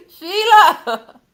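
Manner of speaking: loud high call across the room; calling out Sheila loudly; shout. Real, not acted. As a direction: yell her name out. Calling out to a chat member.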